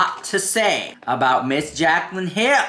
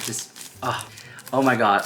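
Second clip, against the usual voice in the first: ordinary delivery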